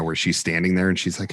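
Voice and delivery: usual speaking voice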